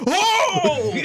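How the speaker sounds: high-pitched retold yelp of alarm; sudden realisation. Host reliving seeing the missed calls, alarmed realisation. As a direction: act out the moment it hits you, high. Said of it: "and my brain goes".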